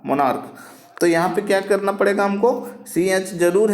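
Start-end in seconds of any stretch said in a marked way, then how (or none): 0.1-0.5 मोनार्क closed on a "k" sound; correct reading. Shown as a demonstration. Teacher repeating the answer for students, मोनार्क with a k ending. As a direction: finish मोनार्क on क rather than च. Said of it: "ठीक है, क्या?".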